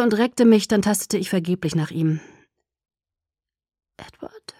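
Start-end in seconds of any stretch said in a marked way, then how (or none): 4.0-4.5 barely audible sleepy whisper; murmured. A narrator's reading. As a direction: murmur the name almost without voice. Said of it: "murmelte ich". (schlaftrunken gemurmelt, fast geflüstert)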